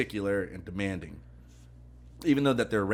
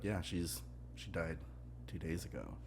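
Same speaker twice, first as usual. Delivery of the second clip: subdued retelling; 5 semitones lower, 14 dB quieter than usual. Retold or imitated. Retelling a father's news of death.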